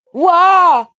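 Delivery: loud, high excited shout; loud wow. Real, not acted. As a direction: shout it in delight. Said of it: "Sorry, I'm being loud".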